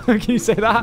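usual speaking voice

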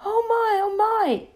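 a touch of tearful distress; mild crying. Acted. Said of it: "began to cry".

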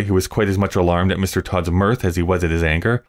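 plain reading voice

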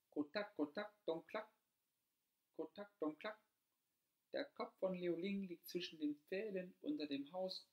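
high clucking hen voice. A narrator's reading. The hen clucking out the new hiding place, high-pitched. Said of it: "gackerte das Huhn wieder".

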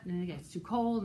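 plain reading voice

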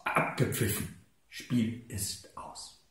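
low, hushed, slowing to a stop; deflated match ending. Acted. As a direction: let the commentary die away at the final whistle. Final whistle ends the match poem. (leiser, langsamer Ausklang nach Abpfiff)

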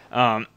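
a sudden dry cough mid-sentence; real cough. Real, not acted. Sick while recording, a real cough. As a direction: cough through a blocked nose. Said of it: "that was a real cough".

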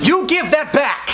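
high, drawn-out mock indignation; mock-stern dad. Dad snapping back at his son's compliment, mock-indignant. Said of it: "so that I can say to him".

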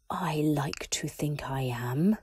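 slow menacing whisper; slow whispered threat; cold Voldemort. Acted. Voldemort answers coldly.

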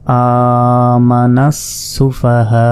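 "সুফাহাউ" with a very long "হা", then a short "উ"; elongated recitation. Shown as a demonstration. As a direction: recite "আমানাস সুফাহাউ" slowly, stretching the long vowels. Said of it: "চার আলিফ". ("সুফাহাউ"-এর "হা" চার আলিফ টেনে, তারপর ছোট "উ")

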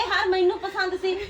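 high, loud indignant yelling; stammering fury, shrill and loud; outraged shout. Acted. Wife to husband, furious he gave away her necklace, shouting.

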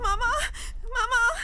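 high, loud tearful calls; terrified child calling mama; crying for mother. Acted. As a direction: sob out for your mother in fright. Frightened girl calls for mother.

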